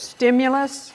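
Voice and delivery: ordinary delivery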